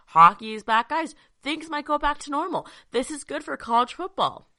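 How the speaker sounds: slightly winded after a workout; out of breath. Real, not acted. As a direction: talk a little short of breath. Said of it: "I'm a little out of breath".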